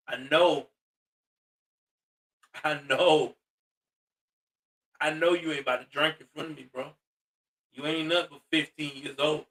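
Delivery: low, quiet warning from a cop; side-eye disapproval. Acted. Neighbor cop warns the underage drinker, low and disapproving. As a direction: keep it down low, knowing and unimpressed. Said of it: "he looking at you on the side eye like".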